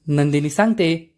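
plain reading voice

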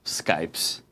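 "Skypes" with a hissed plural S. Shown as a demonstration. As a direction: say "Skypes" with a final S. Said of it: "pronounce it with an S".

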